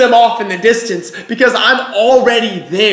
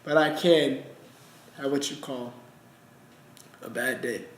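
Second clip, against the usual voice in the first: hushed admission; 9 semitones lower, 14 dB quieter than usual. Acted. God admits having bad days.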